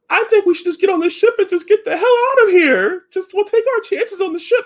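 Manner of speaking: high, teary mock crying plea; imitating crying. Frightened crewmate begging to flee the ship, teary mock crying. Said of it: "she's crying".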